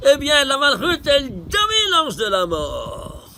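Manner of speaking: a teacher shouting his big reveal to the student; fervent exclamation. Real, not acted. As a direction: proclaim the victory over death, loud and slow. Teacher's climactic point.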